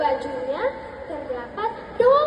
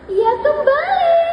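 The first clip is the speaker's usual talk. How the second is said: louder, higher narration; sudden reveal; 7 semitones higher, 6 dB louder than usual. A narrator's reading. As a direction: announce the turn, raised and loud. Sudden return home in story. (narasi mengejutkan, keras dan tinggi)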